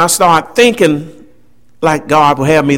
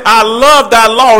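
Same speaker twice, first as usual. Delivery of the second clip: I love thy law, leaned into hard with a raised voice; emphatic; 3 semitones higher, 4 dB louder than usual. Retold or imitated. Preacher quoting the psalmist, emphatic. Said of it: "he says so emphatically".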